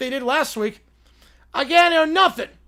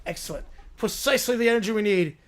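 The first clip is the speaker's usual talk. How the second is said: normal talking voice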